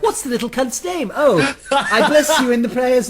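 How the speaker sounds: high, slow mock British toffs; mock posh Brits. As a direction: pitch it up and drawl the posh christening line. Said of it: "they're like".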